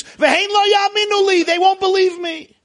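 high, loud protesting cry. Acted. Moshe crying out his excuse to Hashem, high and loud.

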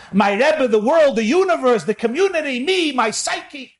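rising, impassioned preacher's cry; preaching at full force. Acted. Rabbi preaching to his listeners, impassioned and rising.

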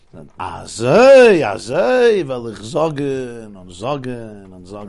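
long, loud drawn-out azay, then slow; resolved exclamation. Retold or imitated. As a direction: savour the decision. Rebbe accepts wife's point.

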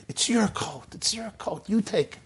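ordinary talk, nothing marked

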